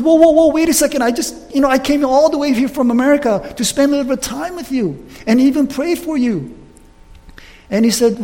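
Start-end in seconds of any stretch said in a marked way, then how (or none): 0.0-6.5 higher, a hurried wait-up plea; startled protest. Retold or imitated. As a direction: stop him before he leaves. Stopping the pastor leaving.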